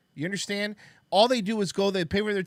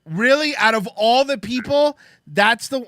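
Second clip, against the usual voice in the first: louder, higher, real surprise; real disbelief; 8 semitones higher, 10 dB louder than usual. Real, not acted. Host reacts to the clip, loud real disbelief. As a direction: blurt it out, genuinely amazed.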